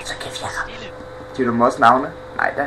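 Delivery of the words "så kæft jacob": hushed whisper at his friend. Real, not acted. Gamer hushes his friend Jacob mid-game, whispering. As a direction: breathe it with no voice, telling Jacob to stop.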